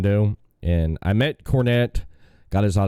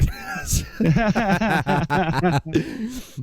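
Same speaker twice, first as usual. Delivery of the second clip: high, long laugh at his own story; a hearty laugh after the Cornette tale; 12 semitones higher than usual. Real, not acted. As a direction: laugh out the punchline.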